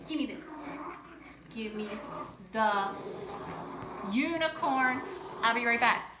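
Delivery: loud insistent give-me; firm demand. Real, not acted. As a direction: demand it back firmly. Demanding toy from someone off-camera.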